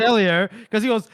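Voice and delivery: ordinary talk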